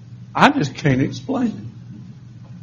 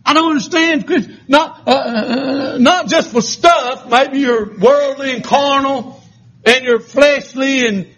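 preaching from level to a high shout; building sermon; 8 semitones higher, slower than usual. Acted. Preacher thanking God for the unspeakable gift, rising to a shout. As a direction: climb to a full-throated shout on the gift.

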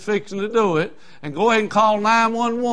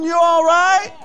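mocking high question; 11 semitones higher, 6 dB louder, slower than usual. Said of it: "mocking the world's message".

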